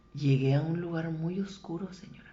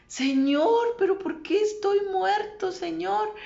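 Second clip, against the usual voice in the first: high, loud plea to God; imitated desperate shouting; 14 semitones higher, 8 dB louder than usual. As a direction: cry out to the Lord, pitched way up. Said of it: "dice que gritaba". (súplica a gritos, aguda y desesperada)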